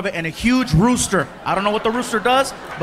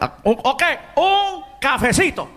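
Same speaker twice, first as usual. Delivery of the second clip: Cuban waiter; 5 semitones higher than usual. Retold or imitated. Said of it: "he's like".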